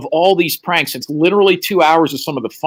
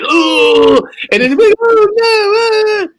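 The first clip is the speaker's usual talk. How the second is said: high shouted mock horror; squealing audience horror, loud and high; mock horrified cry; 4 dB louder than usual. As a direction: shriek in fake shock. Callers shocked by devil picture.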